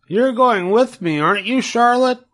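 a piglet eagerly asking his friend along; Wilbur the pig. Acted. Wilbur asks Charlotte to come, high hopeful piglet voice.

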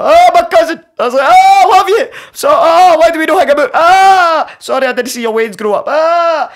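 high, loud wailing release of grief; anguished wailing. Retold or imitated. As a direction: wail it out for the lost cousin. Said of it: "Letting all these sounds out".

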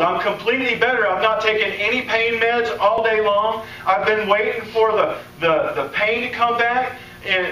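high and excited; healed man telling the preacher, excited; excited retelling. Retold or imitated. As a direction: sound amazed he's pain free. Caller excitedly describes healing.

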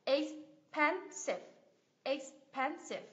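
expensive very slowly, 'ex... pen... sive'; drawn-out, syllable-by-syllable expensive; three separate syllables. Shown as a demonstration. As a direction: count out the syllables of expensive with gaps. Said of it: "cằm của mình di chuyển ba lần".

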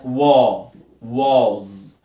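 "وا" then "واز" with a long open aw; AW sound drill. Shown as a demonstration. Teacher shows A after W, long "وا، واز". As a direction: hold a low, rounded aw in "وا" and "واز". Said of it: "بص كده معايا".

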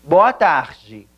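BOA TARDE as "BOH-ah TAH-jee"; breathed r and "jee" ending in BOA TARDE; Portuguese pronunciation. Shown as a demonstration. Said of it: "en portugués es".

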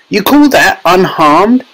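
high, indignant retort; snapping. A narrator's reading. Mother challenges Wonka about tiny son, indignant snap. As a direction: throw it back slowly, indignant and high. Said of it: "snapped Missus Teavee".

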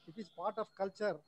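very faint, far from the mic; barely audible. Real, not acted. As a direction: voice fading almost to nothing. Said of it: "your voice is very low".

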